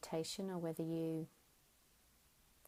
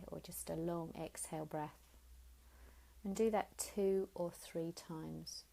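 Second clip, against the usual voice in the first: guided meditation. Acted. Teacher guiding listeners lying down, gentle guided meditation. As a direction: ease them into stillness, unhurried. Leads a Yoga Nidra relaxation.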